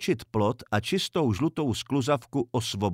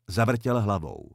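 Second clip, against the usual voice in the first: usual speaking voice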